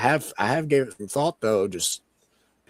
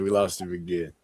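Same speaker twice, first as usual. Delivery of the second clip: ordinary talk, nothing marked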